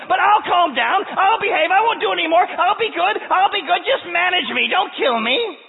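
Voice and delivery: panicked creature screaming please; terrified serpent. Acted. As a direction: beg frantically for your life. Serpent begs for its life.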